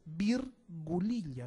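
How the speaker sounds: virgulilla slowly, with VIR set apart; syllable by syllable. Shown as a demonstration. Teacher spells out a new term.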